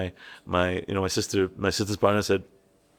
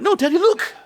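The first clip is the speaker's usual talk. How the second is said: put-on high, loud kid squeal; excited child; 7 dB louder than usual. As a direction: insist to dad like a thrilled child. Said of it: "And then be like".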